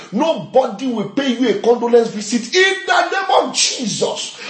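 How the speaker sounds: high, emphatic preacher's proclamation; prophetic decree. Real, not acted. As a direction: declare protection with full conviction. Decrees no bereavement for congregation.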